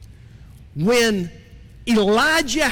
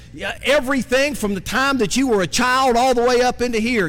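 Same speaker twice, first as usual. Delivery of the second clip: normal talking voice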